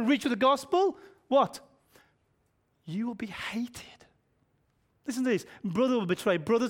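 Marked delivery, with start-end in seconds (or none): 2.8-3.9 hushed whisper, slower; whispered warning to the congregation. Acted. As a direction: breathe it like a secret. Preacher drops to whisper.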